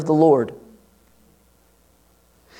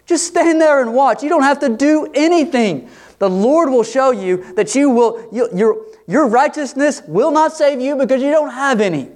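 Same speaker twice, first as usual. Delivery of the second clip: much higher, urgent and insistent, as Moses; fervent proclamation; 10 semitones higher than usual. Retold or imitated. Moses preaching to the fearful people, fervent and soaring. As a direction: cry out that the Lord will act. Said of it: "he's like".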